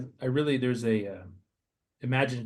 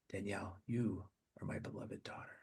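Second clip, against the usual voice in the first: hushed Jesus; 2 semitones lower, 13 dB quieter than usual. Acted. Jesus speaks to listener.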